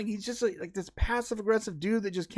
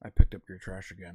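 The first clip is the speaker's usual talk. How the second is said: very low, droning neighbor imitation; mock his needling remark in a deep voice; deep, understated reproach; passive-aggressive neighbor; 14 semitones lower than usual. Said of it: "passive aggressive thing where he's like".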